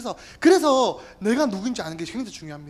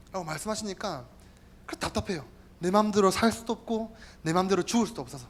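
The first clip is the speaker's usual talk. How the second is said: ordinary delivery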